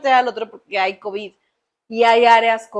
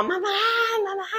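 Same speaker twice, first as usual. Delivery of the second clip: imitated child's scream for mum; retold screaming; 10 semitones higher, 4 dB quieter than usual. Retelling her son in the cold shower, mock screaming. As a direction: cry out like a panicking child. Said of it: "y gritaba".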